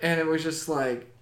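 normal talking voice

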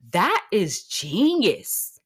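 drawn-out, slow admiring; slow savoring. Real, not acted. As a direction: savor each word slowly. Savoring the block button.

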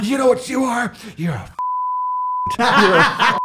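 high, shouted confrontation; hammed-up furious comeback; gorillaed-up mock rage. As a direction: act out squaring up like a silverback, sputtering. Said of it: "he gorillaed, silverbacked".

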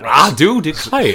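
slow, loud Southern drawl; Southern gentleman. Acted. Host mocking the impression, hammed-up Southern gentleman. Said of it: "Just doing a Southern gentleman".

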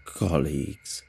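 a distorted alien greeting; eerie low alien speaker voice; crackling alien voice. Acted. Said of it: "the voice crackles and roars".

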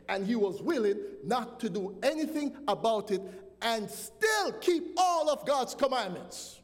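fervent preacher pressing the point; preaching at full force. Acted. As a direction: build the charge like a pulpit climax. Preacher builds to a climax.

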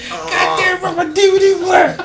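high, hammed-up wail over a broken player; mock upset friend. Friend crying over his crushed DVD player, high mock wail. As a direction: whine like your stuff just got stomped.

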